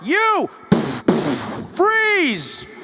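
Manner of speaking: high, loud barked command; a trigger-happy American cop yelling at a suspect; cop shouting. Retold or imitated. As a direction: yell it like a cop drawing a gun.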